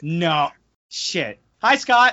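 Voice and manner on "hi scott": cheery greeting. Real, not acted. Greeting a guest.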